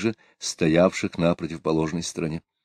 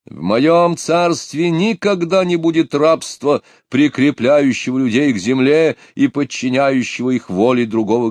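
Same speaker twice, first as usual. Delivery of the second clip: proclamation; 4 semitones higher than usual. A narrator's reading. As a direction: proclaim it like a tsar. Pugachev's speech continues.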